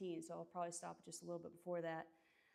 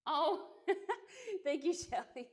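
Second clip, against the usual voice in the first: high, loud laughing thank-you; flattered by a viewer's compliment, laughing; 10 semitones higher, 6 dB louder than usual. Real, not acted. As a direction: laugh while thanking her.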